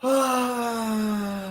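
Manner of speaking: long drawn-out sigh with a sob in it; a pained, groaning sigh of defeat; long weary sigh. Real, not acted. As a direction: sigh out all the frustration. Upset fan after costly timeout.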